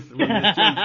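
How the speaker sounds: words broken up by laughter; giggling out the punchline; laughing through it. Real, not acted. Laughing at his own story to friends.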